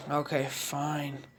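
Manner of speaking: slow, soft reluctant agreement; resigned, drawn-out consent; giving in. A narrator's reading. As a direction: drag it out as you concede. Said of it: "Naruto finally gives in".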